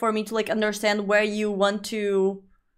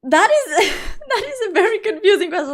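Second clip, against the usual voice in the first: laughing; 9 semitones higher, faster than usual. Real, not acted. Laughing at a chat question.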